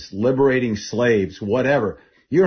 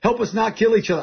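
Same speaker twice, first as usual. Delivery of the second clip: urgent pleading prayer, pitched high; a desperate battlefield plea; prayer under fire, raised up high; retold prayer; 10 semitones higher, 3 dB louder than usual. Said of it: "I said that prayer".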